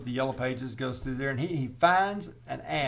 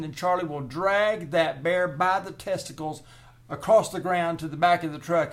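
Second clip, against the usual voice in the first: louder and higher, fast, building the hype; hyped-up bravado; 5 semitones higher, 5 dB louder than usual. Retold or imitated. Bear remover hyping his plan to the homeowner, loud and fast. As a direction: sell the plan like a wild showman.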